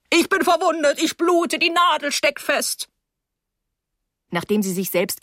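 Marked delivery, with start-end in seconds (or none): 0.1-2.9 higher hammed-up cry of pain; mock yell, higher, pricked finger; mock wailing. Paula stabbed by the needle, higher hammed-up wail. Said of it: "schrie wie am Spieß".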